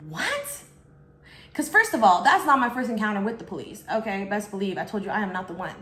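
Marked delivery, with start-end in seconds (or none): 0.1-0.5 high, stunned what; shocked what. Retold or imitated. Hearing the police call, a stunned high what. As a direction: react in disbelief. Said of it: "And I was like".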